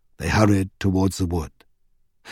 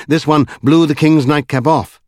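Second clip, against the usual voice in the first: ordinary delivery, nothing marked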